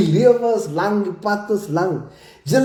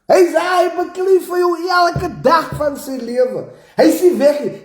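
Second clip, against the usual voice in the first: shouting much higher in real anger; real angry shout; 8 semitones higher than usual. Real, not acted. Scolding spinners who doubt McKenzie, real angry shout. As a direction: yell it out in frustration. Heated rant defending McKenzie.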